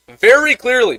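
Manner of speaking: very clearly pushed high; rising outrage; emphatic. Real, not acted. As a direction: lean hard on very clearly.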